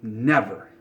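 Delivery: ordinary talk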